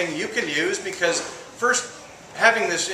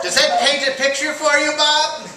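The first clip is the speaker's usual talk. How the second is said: put-on very high, louder elderly lady's voice; old woman; 12 semitones higher, 6 dB louder than usual. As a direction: pipe up high like an old lady. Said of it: "The Indian calls the old woman".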